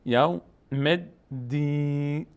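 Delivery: یوم الدین very slowly in syllables, ending "dī-ne"; final kasra sounded. Shown as a demonstration. Teacher breaks down the word, یوم الدین slowly with final kasra "ne". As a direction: sound a short "e" after the last "n" of یوم الدین. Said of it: "اینجا کسره داره نون".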